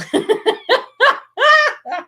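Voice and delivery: squealing laugh; high burst of laughter. Real, not acted. Laughs at own drizzle joke.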